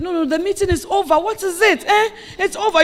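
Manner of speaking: hammed-up husband ending the meeting; husband brushing it off. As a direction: wave her off like a busy husband. Husband cutting her off.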